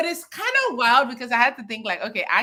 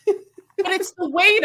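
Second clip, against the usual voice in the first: laughing plea; 9 semitones higher, 5 dB louder, much slower than usual. Real, not acted.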